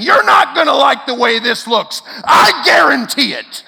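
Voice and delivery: loud, high threat yelled while storming out; ousted CEO yelling at the board as he leaves; angry parting shout. Retold or imitated. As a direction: bark a threat on the way out the door. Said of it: "storming out saying".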